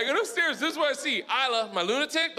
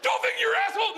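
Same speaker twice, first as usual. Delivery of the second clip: high-pitched exasperated scolding; mock parental yell; faster than usual. Dad imagining scolding his toddler, mock exasperated yell.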